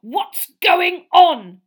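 slow, loud, high-pitched outrage; furious growl. Acted. Uncle rounding on Harry, slow furious growl. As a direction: bite out each word in fury. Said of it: "growled Uncle Vernon".

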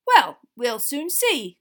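slow, loud, unimpressed growl. A narrator's reading. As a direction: growl it as a veiled threat. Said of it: "growled Mister Diggory".

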